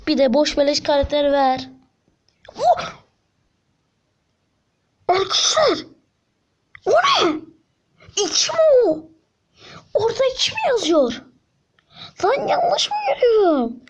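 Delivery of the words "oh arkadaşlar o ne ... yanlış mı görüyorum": squealing astonished disbelief; stunned shock. Real, not acted. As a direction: gasp it like you can't believe your eyes. Shock at the reward.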